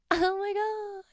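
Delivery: high, airy mock swoon; mock giggly delight. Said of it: "And I'll be like".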